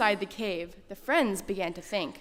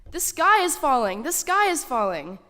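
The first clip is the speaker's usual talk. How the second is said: loud, high panicked cry of the falling sky; panicked scream; 5 semitones higher, 6 dB louder than usual. A narrator's reading. Storyteller voicing Chicken Little's panicked scream to children. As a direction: shout it in a panic, pitched up. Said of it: "Chicken Little screamed as he ran".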